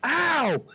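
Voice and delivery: high, loud "ow"; pained yelp. Acted. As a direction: yelp it as if just stabbed. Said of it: "He's like".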